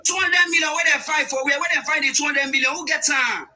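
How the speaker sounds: loud, furious tirade; angry shouting. Real, not acted. Debater yelling at his opponent about missing millions, furious. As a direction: shout him down, hammering each question.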